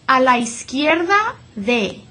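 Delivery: "izquierda" as iskierda, u silent; model Spanish. Shown as a demonstration. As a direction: say "a la izquierda de" without the u. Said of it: "One more time".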